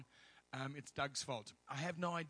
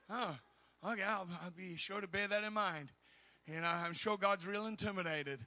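higher-pitched mock agreement; sarcastic; 5 semitones higher, 5 dB louder than usual. Preacher answering the doubters, sarcastic mock acceptance. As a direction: play along brightly with heavy irony.

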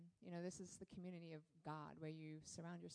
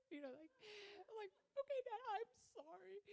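put-on sheepish little child. Retelling her apology to God as a little girl, very high and childlike. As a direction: apologize like a caught kid to her dad.

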